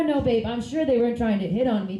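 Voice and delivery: usual speaking voice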